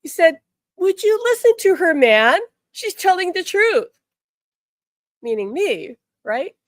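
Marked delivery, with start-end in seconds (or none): 0.8-3.9 high, loud, pressing; emphatic relayed message. Retold or imitated. Archangel telling husband to believe his wife, high and loud. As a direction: urge the man to listen, pitched way up.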